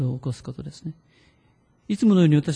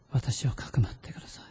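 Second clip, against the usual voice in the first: whispered plea; 2 semitones lower, 7 dB quieter than usual. Shown as a demonstration.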